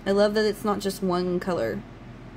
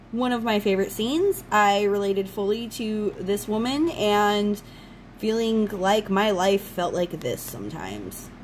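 usual speaking voice

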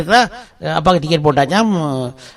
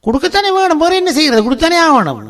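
much higher-pitched, pleading insistence; exasperated insistence; 11 semitones higher than usual. Acted. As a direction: insist there is no other choice. Husband insists they must pay.

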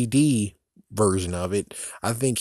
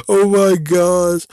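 much louder, slow laughing gasp; 6 semitones higher, 10 dB louder, slower than usual. Real, not acted. Vlogger laughing at his Macbeth joke, loud laughing gasp.

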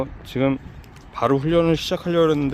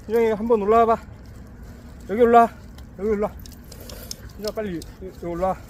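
calling out; 8 semitones higher, faster than usual. Real, not acted. Calling dog up.

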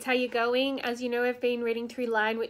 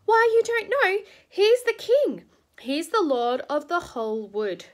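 much higher, louder astonished exclaim; amazed beaver; 7 semitones higher, 5 dB louder, slower than usual. A narrator's reading. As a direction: marvel that they don't know.

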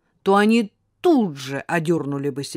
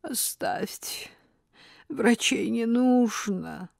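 slow, faint whisper; weak voice; 6 semitones higher, much slower than usual. Acted. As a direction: barely breathe the words out. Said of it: "слабым голосом".

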